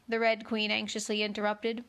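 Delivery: ordinary delivery, nothing marked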